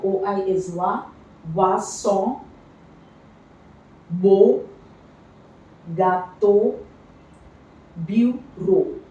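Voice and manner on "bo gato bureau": "bo", "gato", "bureau" ending on a clear o, the first one punched louder; teacher modelling EAU words; EAU as O demo. Shown as a demonstration. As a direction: say each EAU word with a round o. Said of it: "E A U is pronounced as O".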